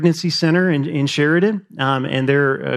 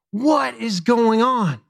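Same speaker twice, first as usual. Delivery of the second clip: slow, high, drawn-out wondering; stretched, raised-pitch astonishment; acted bewilderment; 5 semitones higher, slower than usual. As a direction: imitate an overwhelmed expectant mother in disbelief. Voices Mary's bewilderment.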